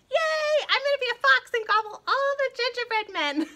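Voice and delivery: very high, loud cheering puppet voice; excited puppet. Pretend. Puppet cheers about gobbling gingerbread men. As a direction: cheer with giggly glee.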